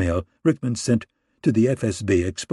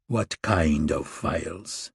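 slow, soft and lower, wary; cautiously; 6 semitones lower, 5 dB quieter, much slower than usual. A narrator's reading. Durrani asking Rickman about the files, cautious and soft. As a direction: ask it carefully, holding back. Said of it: "Durrani said cautiously".

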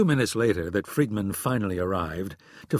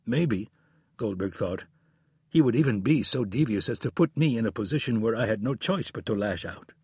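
usual speaking voice

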